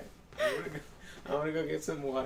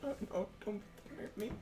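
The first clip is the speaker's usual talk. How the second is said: mock scared; 9 semitones higher, 7 dB quieter, faster than usual. Mock fear after teasing.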